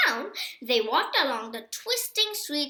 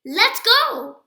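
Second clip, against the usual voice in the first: bright, louder eager "let's go"; eager call; 9 semitones higher, 11 dB louder than usual. A narrator's reading. Morris eager to head into the village, excited. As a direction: call it out, ready to set off.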